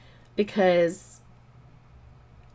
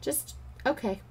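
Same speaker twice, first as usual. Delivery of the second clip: mock dismissive okay; 6 semitones higher, 7 dB quieter than usual. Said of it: "your attitude in return is okay".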